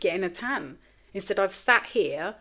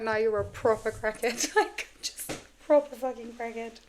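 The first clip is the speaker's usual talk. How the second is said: higher-pitched retort to yourself, breaking into laughter; laughing self-mockery; 4 semitones higher than usual. Podcaster ridiculing her own timed-safe idea, laughing self-reproach. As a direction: laugh as you call yourself out.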